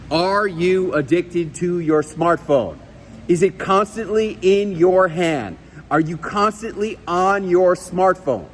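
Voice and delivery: slow, deliberate, emphatic presenter questions; model delivery. Shown as a demonstration. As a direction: spell out each question for the trainee. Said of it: "That's what you need to say".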